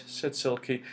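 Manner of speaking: ordinary delivery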